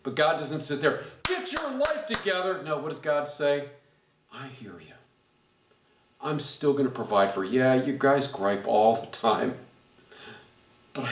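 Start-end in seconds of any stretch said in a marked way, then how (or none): 1.2-2.6 scolding. Pretend. God scolding, imagined. 4.3-7.6 reassuring. Pretend. God's reassuring reply.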